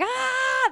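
Exorcist-style scream. Retold or imitated. Mother screaming at small kids, Exorcist-style scream. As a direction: shriek like a possessed mother. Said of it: "The Exorcist style".

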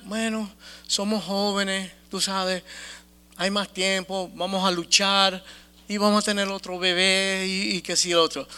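softly voiced mild reassurance, imagined; quiet, resigned pep talk in retelling; consolándose. Da voz al consuelo de la pareja. (consuelo imaginado, en voz baja)

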